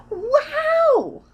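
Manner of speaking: very high, loud, drawn-out wow; soaring, exaggerated astonishment; hugely amazed. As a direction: wail the wow in wonder. Tina's biggest amazement.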